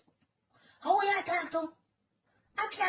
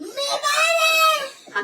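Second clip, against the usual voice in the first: wailing; 7 semitones higher, much slower than usual. Real, not acted. Onlooker wails in mock distress.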